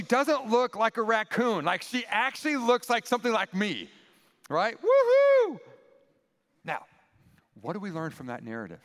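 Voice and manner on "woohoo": loud, high cheer; joyful whoop. Acted. Adam celebrating his new companion, a loud high whoop. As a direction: whoop with glee.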